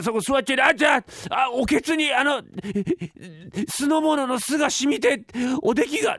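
high, fast, stammering scramble to hide the food; frantic clerk. Acted. Clerk orders the servants to hide the dishes, frantic and fast. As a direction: hide the evidence in a blind panic.

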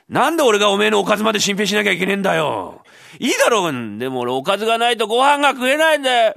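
high, loud frustrated rant; exasperated rant. Acted. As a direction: blow up at the endless demands. Thief explodes at the beggar. (甲高く声を荒げてぼやく)

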